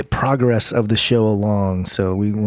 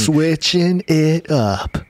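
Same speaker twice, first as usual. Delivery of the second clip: slow, drawn-out radio DJ voice; shock jock radio voice; 6 semitones higher, much slower than usual. Real, not acted. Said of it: "went into my shock jock radio voice".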